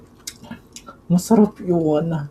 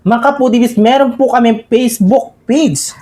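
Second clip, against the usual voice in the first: excited vlogger; 8 semitones higher, 6 dB louder than usual. Acted. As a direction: hype up the viewers. Vlog outro call to viewers.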